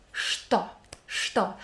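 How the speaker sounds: что said as "што", the "ч" softened to "ш"; ch read as sh. Shown as a demonstration. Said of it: "We read ch as sh".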